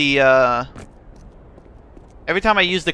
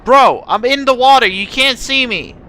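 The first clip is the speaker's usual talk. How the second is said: excited shout; 8 semitones higher, 8 dB louder than usual. Real, not acted. Excited in game.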